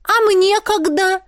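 high, drawn-out indignant whine. A narrator's reading. Granddaughter left out of the plan, indignant. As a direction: protest in a high, slow voice. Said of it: "возмутилась Марьяна".

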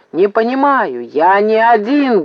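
insistent acted shout; barking. A narrator's reading. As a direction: bark it back, stretching the words. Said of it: "гаркнул Максим".

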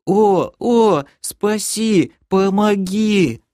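very high, pitiful creature cry; wailing plea. A narrator's reading. Captive creature begging a friend for help, wailing plea. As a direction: beg for rescue in a drawn-out piercing wail. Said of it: "завопил Лохер".

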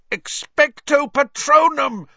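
high, full-force shouted spell; loud, high-pitched battle cry; desperate yell. A narrator's reading. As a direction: yell the spell over the screaming. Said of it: "Harry yelled".

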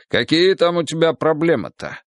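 mildly insistent, voice lifted. A narrator's reading. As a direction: press your friend for an answer. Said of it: "настойчиво повторил Тимоха".

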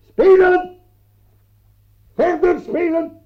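high, loud barked command; shouted order. Acted. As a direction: shout spelen at the player. Ordering the player to continue.

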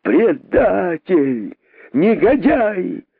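slow high outraged cries; angry mob. A narrator's reading. Crowd denouncing the traitor, furious.

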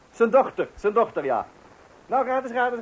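ordinary talk, nothing marked

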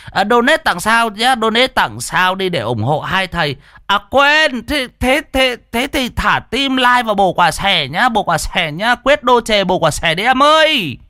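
high, excited streamer begging for donations; sing-out high livestreamer plug; loud livestream babble. A narrator's reading. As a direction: hype up the viewers for likes and gifts. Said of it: "dí cái smartphone mà bô bô".

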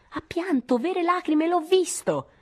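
high, slow triumphant cry. A narrator's reading. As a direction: crow it, stretching out the words. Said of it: "disse in tono trionfante".

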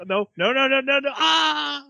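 hammed-up dread building to a squeal; Henri's mock panic. Host acts out Henri awaiting the pour, mock panic. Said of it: "It's just Henri, like".

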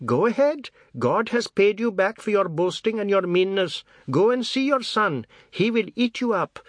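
high, loud spiteful taunt; taunting old woman. A narrator's reading. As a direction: taunt him with a curse. Grandmother taunts the father.

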